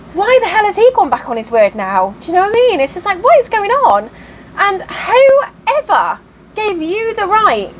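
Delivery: incredulous rant about a broken promise; exasperated outrage. Real, not acted. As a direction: pitch shoots up as frustration boils over. Ranting about betrayal over the land.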